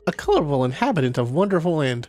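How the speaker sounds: bubbly Cassie voice. Acted. Reading Cassie's profile aloud in a high perky character voice. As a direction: play the perky artist introducing herself.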